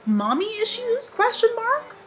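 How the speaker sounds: high, rising sing-out query; exaggerated questioning. Real, not acted. Reviewer wondering about mommy issues, exaggerated questioning. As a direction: float each phrase up as an open question.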